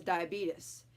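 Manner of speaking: "diabetes" ending in "-beet-us"; grandma's mispronunciation. Retold or imitated. Quoting grandma telling people, "diabetes" as dye-uh-BEET-us. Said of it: "My grandma's a classic example of that".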